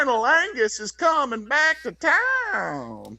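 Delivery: high, slow antebellum Southern belle drawl; hammed-up old Southern accent, drawn out high; Georgia Peach Southern accent. Mocking actors with wrong accents, Georgia Peach Southern accent. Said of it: "Georgia Peach eighteen twenties Southern accent".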